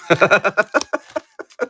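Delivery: high chuckling laugh; real chuckle running on. Real, not acted. Streamer thanking a buyer, amused laugh.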